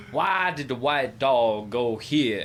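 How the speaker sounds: put-on slow drawn Boston accent. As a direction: stretch the vowels like a Bostonian. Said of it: "saying a Boston accent".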